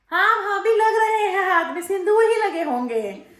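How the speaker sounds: high, loud mock-innocent maid voice; mimicking the maid. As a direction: ham up her cheeky excuse, high and drawn out. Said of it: "फिर महारानी की बात सुन लो". (भोली बनती नौकरानी की ऊँची, खिंची आवाज़)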